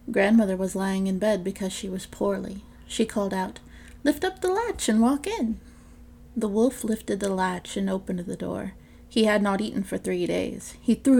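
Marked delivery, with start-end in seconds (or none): 4.0-5.6 granny calling out. Acted. Sick grandmother calls out.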